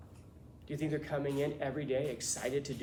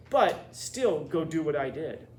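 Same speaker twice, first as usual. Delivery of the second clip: mock teacher advice; 7 dB louder, slower than usual. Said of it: "you're gonna say".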